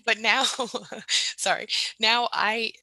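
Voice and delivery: loud, choked-up laugh through the words; real near-crying, apologetic and laughing; tearful laughing. Real, not acted. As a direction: fight back tears while laughing. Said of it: "I'm not gonna cry or anything".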